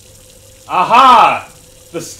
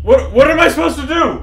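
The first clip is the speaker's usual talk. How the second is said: panicked; 9 semitones higher, 5 dB louder than usual. Real, not acted. Panicking during countdown.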